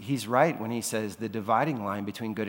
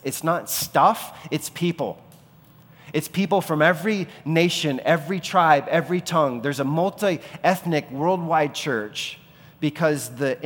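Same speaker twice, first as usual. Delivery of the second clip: preaching at full force; 4 semitones higher, 6 dB louder than usual. Acted. Preaching the inheritance of Jesus.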